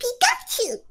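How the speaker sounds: very high squeaky Pikachu cry. Pretend. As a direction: squeal it like the cartoon mouse. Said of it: "please do Pikachu".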